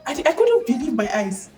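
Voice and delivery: ordinary talk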